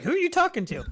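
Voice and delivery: high-pitched imitation of a puzzled man; mock guard voice. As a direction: squeak out the guard's question.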